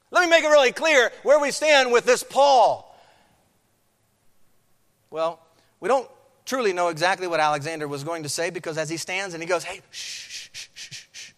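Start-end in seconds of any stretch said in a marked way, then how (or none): 0.1-2.9 loud, high public declaration; emphatic spokesman. Acted. Voicing Alexander addressing the crowd. 9.6-11.3 soft, repeated shushing; hushing a crowd. Acted. Alexander trying to hush the crowd.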